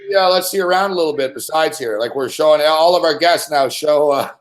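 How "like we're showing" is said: ordinary talk, nothing marked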